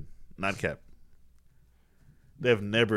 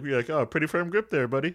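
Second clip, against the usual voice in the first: playful quote; 9 semitones higher, 4 dB louder than usual. Retold or imitated.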